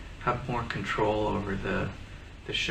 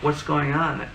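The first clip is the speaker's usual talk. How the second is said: exasperated what's going on; 5 semitones higher, 6 dB louder, slower than usual. Retold or imitated. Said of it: "I'd be like".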